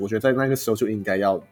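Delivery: ordinary delivery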